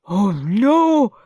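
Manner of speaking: loud shocked gasp of a teacher. A narrator's reading. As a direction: gasp it out, aghast. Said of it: "gasped Mister Nurden".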